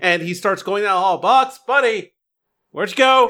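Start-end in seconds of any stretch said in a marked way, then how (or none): 1.2-3.3 higher-pitched searching call. Acted. Wizard going down the hall after his owl, calling out. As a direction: coax a lost pet from down the hall. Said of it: "shouting in the hallway for Bucks".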